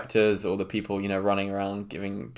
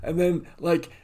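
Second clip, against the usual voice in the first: surfer-dude horror victim; 7 semitones higher, 5 dB louder than usual. Acted. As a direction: play the gnarly surfer who dies first. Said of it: "that classic character from a horror film".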